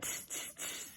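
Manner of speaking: whispered tss tss of mocking monkeys; teasing whispered tsk-tsk. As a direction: hiss it like cheeky monkeys. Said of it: "and said".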